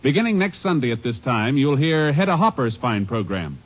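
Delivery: normal talking voice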